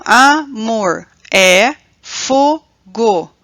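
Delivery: "amor, é, fogo" very slow, split into a-mor, é, fo-go; syllable by syllable. Shown as a demonstration. Teacher splitting a verse to count metre, syllable by syllable. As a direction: separate each syllable of "amor, é, fogo" with a pause. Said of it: "primeiramente separar as sílabas".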